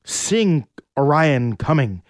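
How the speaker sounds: ordinary delivery, nothing marked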